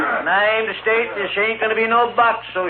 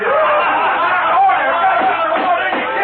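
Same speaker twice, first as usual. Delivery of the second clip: high laugh; 12 semitones higher, 4 dB louder than usual. Acted. Courtroom erupts at defiance.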